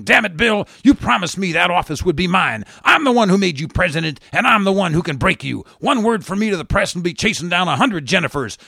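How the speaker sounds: high, loud angry threats at her husband; shouting. Acted. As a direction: shout him down. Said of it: "The First Lady shouting".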